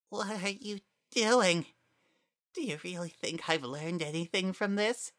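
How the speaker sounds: a defeated monster still taunting; sneering flower. Acted. Flowey voiced in story.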